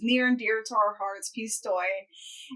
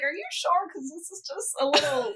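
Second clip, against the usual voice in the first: disbelieving question; 4 semitones higher than usual. Retold or imitated. Quoting her question to Mike.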